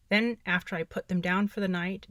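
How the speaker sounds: ordinary talk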